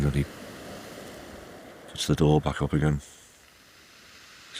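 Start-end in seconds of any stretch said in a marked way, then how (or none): none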